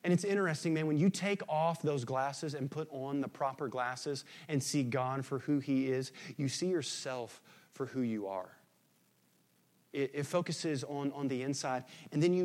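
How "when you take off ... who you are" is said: normal talking voice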